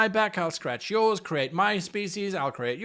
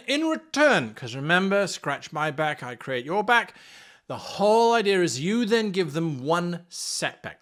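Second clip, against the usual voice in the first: usual speaking voice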